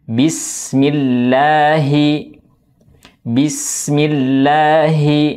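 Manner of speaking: "Bismillahi" slow, each kasrah i drawn out with the jaw dropped; over enunciating. Shown as a demonstration. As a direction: stretch out "bismillahi" with a full, open i. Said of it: "mendamparkan rahang bawah".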